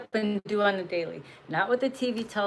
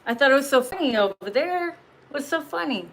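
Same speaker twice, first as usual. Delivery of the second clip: higher, louder mocking taunt; 5 semitones higher, 4 dB louder than usual. Mocking the hyenas now cowering, a taunting jab. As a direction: tease them for laughing.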